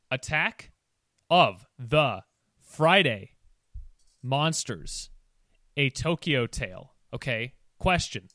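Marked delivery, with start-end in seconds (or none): 0.1-6.9 slow, word by word title; over enunciating. Shown as a demonstration. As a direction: spell it out slowly, each word apart. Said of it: "I will say it slowly".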